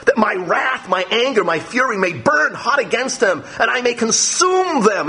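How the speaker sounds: high, heated voice of an angry God; furious divine warning, pitched high. Acted. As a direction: let God's fury burn through the words. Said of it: "Notice God is so angry".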